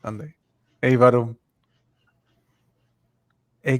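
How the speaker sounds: ordinary talk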